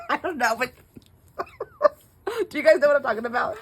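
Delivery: talking through real laughter, high and fast; laughing. Real, not acted. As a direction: laugh as you try to get the question out. Laughing at her own explanation.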